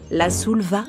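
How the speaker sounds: ordinary delivery, nothing marked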